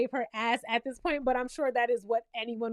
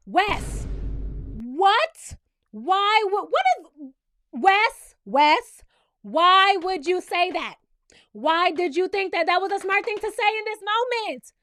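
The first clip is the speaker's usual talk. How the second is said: louder, incredulous scolding; exasperated disbelief; 4 semitones higher, 5 dB louder, slower than usual. Acted. Host scolding Wes for his threat, exasperated. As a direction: demand an explanation, punching each word.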